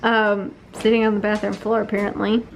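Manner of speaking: usual speaking voice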